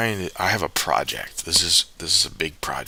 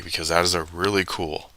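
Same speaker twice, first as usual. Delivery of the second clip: ordinary talk, nothing marked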